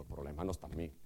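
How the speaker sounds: low, muttered self-excuse; mock denial. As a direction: brush off the blame under your breath. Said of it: "Saúl diciendo".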